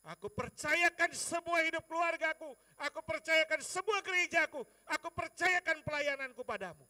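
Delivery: high, shouted prayer of surrender; fervent declaration. Retold or imitated. Preacher entrusting family and church to God, fervent shout. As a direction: declare your trust at full force.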